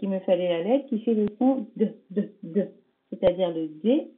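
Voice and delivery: ordinary talk, nothing marked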